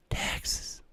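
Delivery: breathy whispered word. Real, not acted. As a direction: whisper it flatly. Whispered reading of chat.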